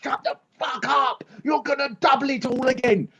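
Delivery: mock scientist bellowing shut up; angry shout. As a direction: yell it at the prime minister in frustration. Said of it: "The scientists go".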